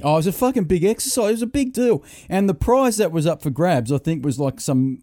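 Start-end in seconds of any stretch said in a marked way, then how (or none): 0.0-3.9 high, fast and emphatic; excited. Real, not acted. Telling his co-host, excited and high. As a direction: pump up how big it was. Excited retelling of class exercise.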